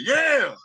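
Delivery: high cheering yeah from the crowd; imitated crowd yeah. Explaining licks that get a crowd reaction, high imitated cheer. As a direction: call it out like a fan in the seats. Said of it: "crowd reaction".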